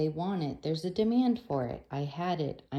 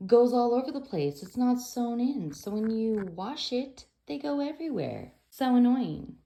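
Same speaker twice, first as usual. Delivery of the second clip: higher and louder, fed-up complaining; exasperated rant; 6 semitones higher, 5 dB louder than usual. Real, not acted. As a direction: vent about the padding going everywhere. Ranting about swimsuit padding.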